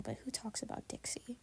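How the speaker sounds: unvoiced snarky aside; whispered aside. Real, not acted. Cohost's catty whispered dig about Dixie.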